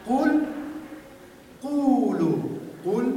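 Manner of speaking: قل and قولو called out high and loud with a pure u; Arabic u vowel. Shown as a demonstration. Teacher drills Quran recitation vowels, high and loud. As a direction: chant قل قولو with a clear Arabic u.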